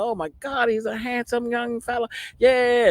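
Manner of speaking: high-pitched gushing mimicry; happy onlookers imitated. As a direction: gush over the newborn like the onlookers. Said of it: "they was all happy".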